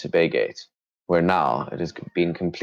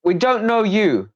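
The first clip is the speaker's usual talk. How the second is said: bandit voice; 4 dB louder than usual. Acted. Bandit answers John's claim, high loud voice. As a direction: refuse them in the squeaky bandit voice.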